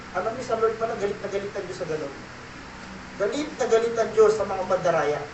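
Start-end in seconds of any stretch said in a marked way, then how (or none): 0.1-2.1 hushed aside. Real, not acted. Hushed aside mid sermon.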